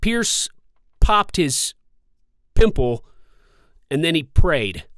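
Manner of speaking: loud bursts on "Pierce", "popped", "pimple", "prayed"; exaggerated popping P's. Shown as a demonstration. Vlogger testing the mic's plosives, exaggerated popping P's. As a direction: punch each P of "popped his pimple", pausing between words. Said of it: "I'm exaggerating by popping here".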